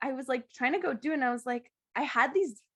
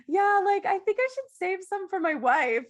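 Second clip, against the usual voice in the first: hammed-up exhausted client voice, pitched up; mock client; 9 semitones higher, 5 dB louder than usual. Imitating a spent client who wants to save some for his wife, pitched up. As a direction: play the worn-out client backing off. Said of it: "one was like".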